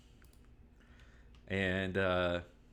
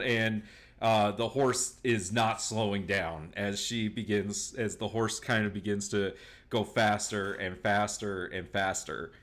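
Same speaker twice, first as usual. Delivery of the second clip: ordinary talk, nothing marked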